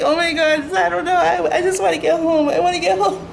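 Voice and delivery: high whimpering plea to get home; high tearful whine, half laughing; whiny pleading. Retold or imitated. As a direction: whine it high, close to tears. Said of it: "I'm like".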